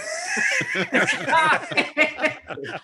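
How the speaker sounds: big burst of laughter; loud laugh. Real, not acted. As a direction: cackle out loud. GM laughing loudly.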